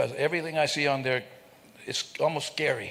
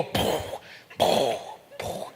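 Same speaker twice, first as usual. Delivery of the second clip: heavy footsteps; much slower than usual. Retold or imitated. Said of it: "he'd go".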